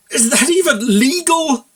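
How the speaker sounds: high, loud, slow choked-up disbelief; shocked squawk. Acted. Buck choking on water at the mayor's story, high and shocked. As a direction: splutter it out in shock.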